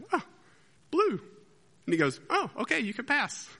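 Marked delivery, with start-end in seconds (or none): 0.1-1.2 hesitant, high-pitched answer; mock-nervous, pause then squeak it out; nervous Lancelot. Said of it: "Lancelot is all nervous". 2.3-3.5 bridge keeper. Acted. Bridge keeper lets Lancelot through.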